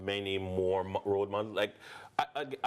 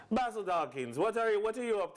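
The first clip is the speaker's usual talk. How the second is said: imitating TK on the phone; 9 semitones higher than usual. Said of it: "and you're like".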